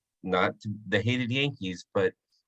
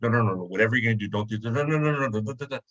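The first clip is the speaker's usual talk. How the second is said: frantic no's; faster than usual. Retold or imitated. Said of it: "my wife looks at me and goes".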